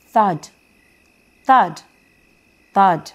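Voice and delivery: loud, heavy thud sounds; booming thuds. A narrator's reading. Storyteller to children, loud booming thuds. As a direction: stamp each thud out loud.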